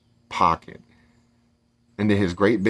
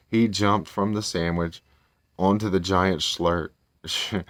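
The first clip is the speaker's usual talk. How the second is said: plain reading voice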